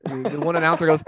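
ordinary talk, nothing marked

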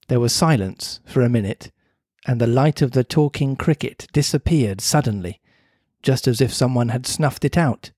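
ordinary delivery, nothing marked

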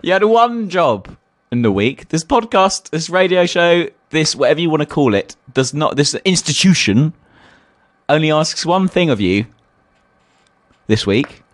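high, hammed-up scolding of a friend; scolding the co-host for skipping the Twix, hammed-up and high; mock outrage.

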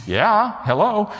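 high-pitched, amused agreement; eager, obvious yes. Retold or imitated. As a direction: say it as if it's a no-brainer. Retells eager yes to meal.

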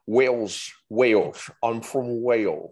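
mock Welsh, rounded vowels; Welsh accent. Shown as a demonstration. Showing friends a Welsh person, mock Welsh accent. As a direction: play someone from Wales with a comic accent. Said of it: "the Welsh accent is very".

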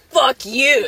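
playful loud "screw you" imitation; mock outburst. Quoting a friend's comeback to the group, mock outburst.

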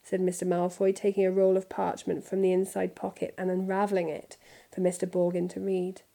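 ordinary delivery

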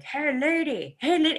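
high, loud mock crow call; crows yelling. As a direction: call out like a crow hailing someone. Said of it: "when they're yelling at me".

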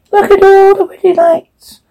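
very high eerie demonic voice; floating, high haunted chant; possessed. Acted. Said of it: "demonic voice".

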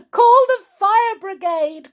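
high, loud cry of alarm; high-pitched emergency shout. A narrator's reading. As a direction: call out urgently for help. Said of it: "cried Missus Cranky".

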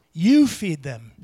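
"You" hit loud, slow and deliberate; "You feed them" with the weight on "You"; stress on you. Shown as a demonstration. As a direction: lean hard on "You", then fall. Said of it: "You have to emphasize words".

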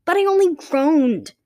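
high, slow pleading protest. Acted. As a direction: defend yourself, a little frightened. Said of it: "the girl protested".

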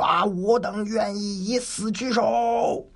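slow, high, solemn group pledge; soldiers' vow. Acted. 众将向曹仁宣誓，缓慢庄重. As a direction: swear loyalty to the death.

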